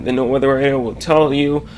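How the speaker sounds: usual speaking voice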